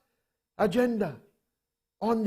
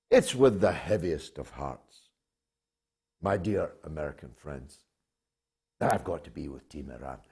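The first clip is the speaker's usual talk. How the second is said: mock heavy heart; 14 semitones lower, 7 dB quieter than usual. Mock grief at backing Iran.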